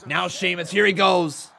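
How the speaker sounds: loud, high commentator calling the count; excited play-by-play. Acted. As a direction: call the action like a wrestling announcer. Calls the match like an announcer.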